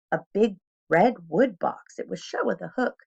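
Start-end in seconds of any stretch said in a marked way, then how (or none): none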